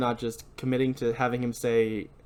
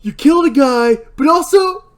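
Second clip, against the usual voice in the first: high, loud hammed-up whining; host parodying Barry's jacket obsession; mocking Barry; 11 semitones higher, 11 dB louder than usual. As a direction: overact the petty grudge. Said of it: "It's like".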